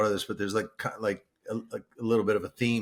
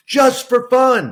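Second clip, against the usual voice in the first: just for fun, drawn out slowly, higher and louder; drawn-out emphatic echo, higher and louder; 12 semitones higher, 14 dB louder, slower than usual. Real, not acted. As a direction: lean hard on each word of just for fun, slow. Echoing partner's phrase emphatically.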